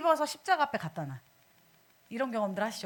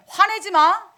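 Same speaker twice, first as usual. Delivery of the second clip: loud, raised-pitch barrage of commands; mock stern Jesus; 4 semitones higher, 8 dB louder than usual. Preacher parodying Jesus forbidding anger to believers, mock stern commands. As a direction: hammer out each prohibition like a strict taskmaster. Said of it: "그러면 예수님이".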